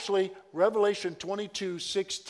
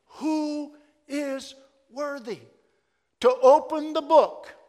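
slow, high ringing call of the angel; angel's loud proclamation; 7 semitones higher, 8 dB louder than usual. Retold or imitated. Angel proclaiming to all heaven, slow and ringing. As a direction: proclaim it like a herald. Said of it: "proclaiming with a loud voice".

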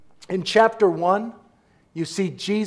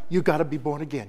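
usual speaking voice

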